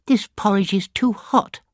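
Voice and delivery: put-on high little girl's complaint; a little girl whining it's too hot; Goldilocks complaining.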